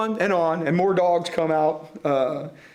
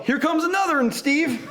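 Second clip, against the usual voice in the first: yell; 9 semitones higher than usual. A narrator's reading. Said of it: "I heard Bat yell".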